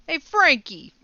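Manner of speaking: loud, high-pitched Jersey mobster call; punchy Jersey accent, calling out. Acted. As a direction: holler it like a Jersey wiseguy. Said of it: "just sounds like a mobster to me".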